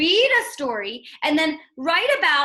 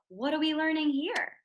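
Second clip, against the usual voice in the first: ordinary talk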